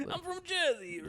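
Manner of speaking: put-on high, hammy Jersey accent. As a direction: say it like a Jersey guy. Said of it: "we just make him, like, Jersey".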